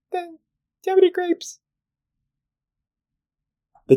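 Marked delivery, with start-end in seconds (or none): 0.1-1.6 very high little duck voice. Acted. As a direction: pipe it up high like a tiny duck. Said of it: "duck asks again".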